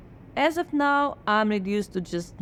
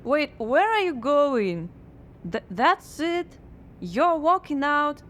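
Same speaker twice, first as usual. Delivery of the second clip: surprised; 6 semitones higher than usual. Acted. Muse walks out.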